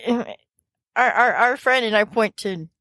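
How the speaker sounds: stuttering, halting excuse; nervous character stammer; flustered fumbling. Acted. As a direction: stumble on the first words of each phrase. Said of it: "she's fumbling over her words".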